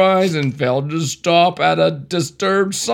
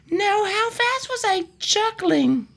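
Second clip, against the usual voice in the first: high, slow squeaky driver; high nervous driver voice; slower than usual. Acted. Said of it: "Do different voices".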